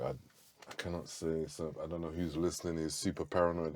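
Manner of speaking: imitating a paranoid dad speaking low; hushed paranoid. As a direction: keep it low like someone afraid of being overheard. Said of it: "He's like".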